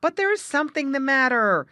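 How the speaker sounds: high, loud protest; insistent. A narrator's reading. Jerry Muskrat contradicting Grandfather Frog, loudly insistent. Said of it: "insisted Jerry Muskrat".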